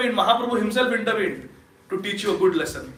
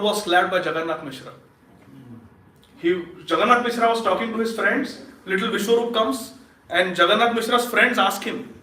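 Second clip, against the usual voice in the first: ordinary talk, nothing marked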